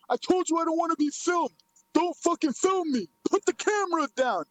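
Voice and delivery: high put-on voice giving orders; mock an irritated man, pitched well up; E B Z impersonation. Streamer imitating a man refusing to be filmed, impersonation. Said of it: "let me do my E B Z impersonation".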